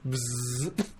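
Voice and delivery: drawn-out bee-like buzz; imitated buzz. Joke answer to a [name] request, imitated buzz. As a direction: buzz through the lips, then stop.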